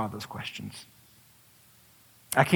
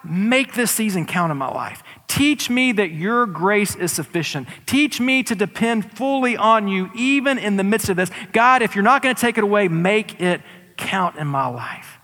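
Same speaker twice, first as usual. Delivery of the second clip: fervent prayer; 7 semitones higher, 4 dB louder than usual. Pretend. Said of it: "what if we said".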